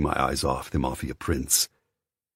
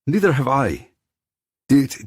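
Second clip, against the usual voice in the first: defiant; 11 semitones higher, 8 dB louder than usual. Acted. Defiant retort.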